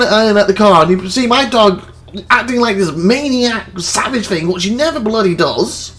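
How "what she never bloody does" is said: normal talking voice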